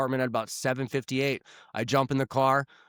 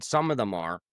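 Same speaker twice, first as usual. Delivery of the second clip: ordinary delivery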